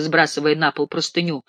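ordinary delivery, nothing marked

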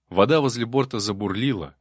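usual speaking voice

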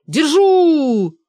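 loud, high, long-held cry; triumphant shout. Acted. Benedikt has hooked the prey, triumphant drawn-out shout. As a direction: yell it out, stretching the last vowel.

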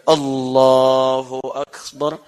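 slow, drawn-out low takbir; takbir salat. Retold or imitated. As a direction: stretch out the takbir as if opening prayer. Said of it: "Perhatikan sahabat Abu Bakar ketika menunaikan salat". (takbir panjang dan khidmat)

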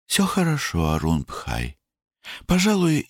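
high-pitched reassuring reply; young sister's voice. A narrator's reading. Sister reassuring her brother, high girlish voice.

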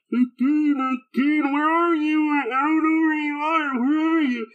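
high, slow, goofy put-on voice; mock Katsura calling from inside a can; clueless Katsura.

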